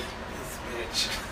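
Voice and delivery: breathy unvoiced aside; hushed, scandalized whisper; mock whisper. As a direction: whisper it under your breath in disbelief. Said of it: "I was just like".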